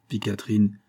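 plain reading voice

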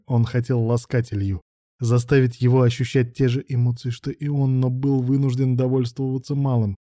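ordinary delivery, nothing marked

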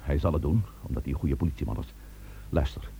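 hushed, fast whisper to his team. Acted. Chief whispering the plan to his team, hushed. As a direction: keep it secret, whisper it quickly. Plotting quietly before a raid.

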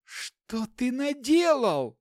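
high slow horrified cry; cry of horror. Acted. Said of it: "вскрикнула Лори". (вскрикнуть в ужасе)

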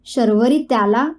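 usual speaking voice

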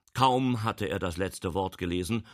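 ordinary delivery, nothing marked

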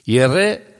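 ordinary delivery

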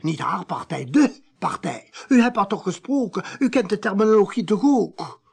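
agitated accusation. Acted. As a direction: rail against the party, building. Priest's rising accusation of the party.

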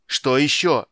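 loud exasperated cry; shouting. A narrator's reading. Captain hears the fox barking from shore, shouting. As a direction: shout the question out. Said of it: "закричал Пудуарак".

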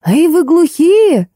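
high, slow, loud worried call; worried old man. A narrator's reading. Old man calls out to the silent guests, fretting loudly. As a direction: call out fretting that they are deaf. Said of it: "забеспокоился дедуля".

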